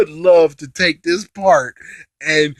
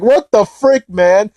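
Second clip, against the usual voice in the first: mock outraged exclamation, pitched up; exasperated; 5 semitones higher, 4 dB louder, slower than usual. Recapper reacting to the fusion blunder, exasperated. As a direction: throw it out in exasperated disbelief.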